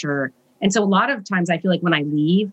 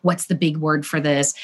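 ordinary talk

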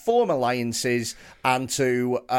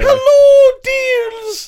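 shrieking posh voice; 13 dB louder, much slower than usual. Pretend. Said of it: "Oh, could you imagine".

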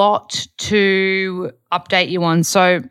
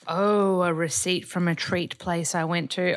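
normal talking voice

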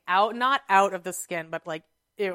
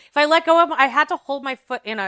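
higher and louder, strained; strained outburst; 6 semitones higher, 7 dB louder than usual. Real, not acted. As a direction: push through the pain. Pain memory, voice jumps up.

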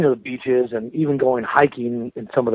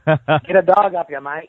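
broad outback Australian drawl, a bit higher; outback Australian accent; 4 semitones higher than usual. Shown as a demonstration. Proving he can do it, guitarist hams up an outback Australian accent. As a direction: lay the Australian bush accent on thick. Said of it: "I can do it great".

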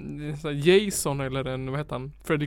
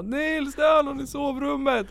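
imitated panicked scream; 10 semitones higher than usual. Wife waking Nils about an intruder in the bedroom, imitated panicked scream. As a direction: shout it up high, imitating a panicked wake-up. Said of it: "när mamma skrek och vaknade".

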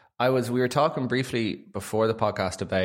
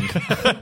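laugh; 5 dB louder than usual. Real, not acted. Laughs at own joke.

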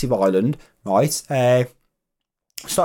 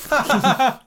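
short laugh; 11 semitones higher than usual. Real, not acted. Laughs at son breaking shelf.

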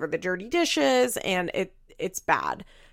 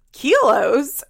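mock disbelief; 6 semitones higher, 8 dB louder than usual. [name]'s incredulous retort.

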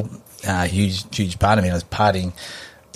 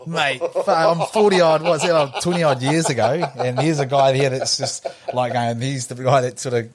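retold disbelief; 6 semitones higher than usual. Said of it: "And I was like".

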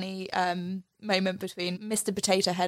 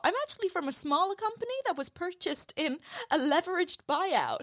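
Rex voice; 10 semitones higher than usual. Retold or imitated. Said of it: "Rex is like".

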